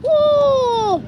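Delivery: a high, loud whoop of delight; excited whoop. Real, not acted. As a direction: cheer it out. Excited reaction while playing.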